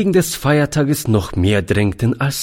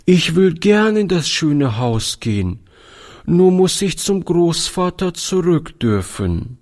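put-on little girl voice; 8 semitones higher than usual.

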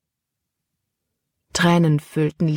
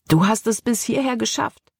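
higher, excited narrated line; enthusiastic; 5 semitones higher than usual. A narrator's reading. Caleb to Jordan as the shackle falls off, enthusiastic. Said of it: "Begeisterung schwang in Calebs Stimme mit".

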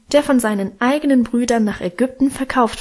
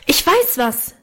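excited, higher-pitched outburst; calling out; 5 semitones higher than usual. A narrator's reading. Guste suddenly has an idea, calling out. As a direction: blurt it out higher, without real volume. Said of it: "rief sie so laut".

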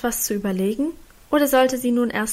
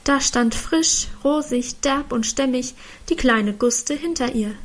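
usual speaking voice

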